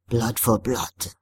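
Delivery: low, slow, hoarse water spirit; waterlogged, ponderous creature; Tarabusao. A narrator's reading. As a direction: draw it out in a deep accented creature voice. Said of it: "hoarse, waterlogged, speaking in an accent so thick".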